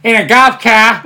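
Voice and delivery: loud, high mock Boston accent; hammed-up Boston holler. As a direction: shout it with Boston dropped r's. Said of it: "it's a Boston accent".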